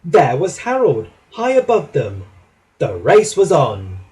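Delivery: plain reading voice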